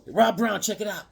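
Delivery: ordinary delivery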